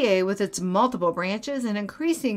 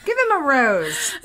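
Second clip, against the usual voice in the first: Bachelor host voice; 8 semitones higher than usual. Pretend. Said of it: "It sounds like The Bachelor".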